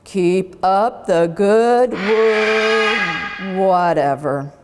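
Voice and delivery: slow, chuckling principal voice; amused and drawn out; chortling principal. Acted. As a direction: principal chuckling at lazy class. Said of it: "say with a chortle".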